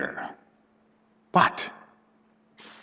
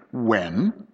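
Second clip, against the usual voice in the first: sternly; 3 semitones lower, 4 dB quieter than usual. A narrator's reading. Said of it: "he spoke sternly".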